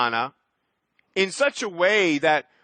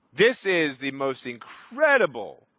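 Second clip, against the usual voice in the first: ordinary talk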